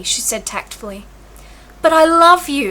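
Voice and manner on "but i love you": louder, higher exclamation; exclaiming. A narrator's reading. Olivia blurting out her love to the disguised Viola, exclaiming. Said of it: "exclaimed Olivia".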